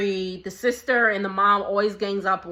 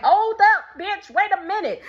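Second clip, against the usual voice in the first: high, loud, exaggerated face-off; confrontational; 10 semitones higher, 5 dB louder than usual. Vlogger acting out what the daughter didn't do, mock confrontational outburst. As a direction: get right in her face, hammed up. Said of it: "It's not like she went, pointed in her mom's face like".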